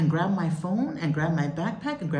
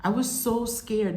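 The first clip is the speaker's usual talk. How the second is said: ordinary talk, nothing marked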